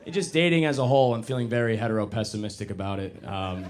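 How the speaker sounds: ordinary delivery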